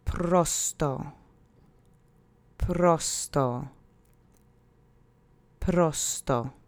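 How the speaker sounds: "Prosto" with a rolled R and stress on PRO. Shown as a demonstration. Teacher modelling prosto with a rolled R. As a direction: model "Prosto" with a trilled R, stress on the first syllable. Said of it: "Try to roll that R if you can".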